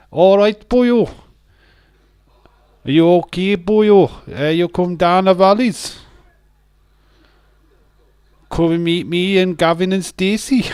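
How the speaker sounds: squeaky Welsh valley caricature; over-the-top Welsh. Shown as a demonstration. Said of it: "Welsh accent".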